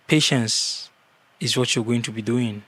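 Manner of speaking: ordinary talk, nothing marked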